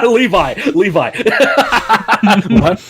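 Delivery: calling out a name through laughter; laughing, then a cackling what. Real, not acted. Laughing at a friend's joke.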